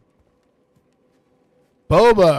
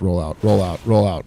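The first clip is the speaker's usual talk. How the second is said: urgent call; 2 semitones lower, 6 dB louder than usual. Real, not acted. Dodging in game.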